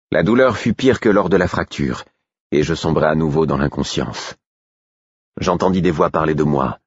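ordinary delivery

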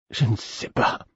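barely voiced, mouth not obeying; faint whisper from a dying boy; weak whisper. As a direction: breathe the words out weakly. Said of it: "ma bouche ne m'obéissait pas bien".

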